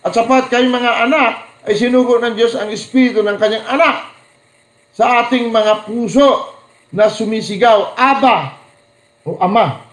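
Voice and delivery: high, loud proclaiming of the verse; scripture reading. Acted. Preacher reading the Abba verse to the congregation, proclaiming. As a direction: ring out the verse. Reads Bible verse aloud.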